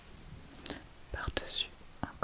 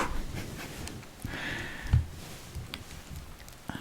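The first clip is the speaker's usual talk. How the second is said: whispered laugh. Real, not acted.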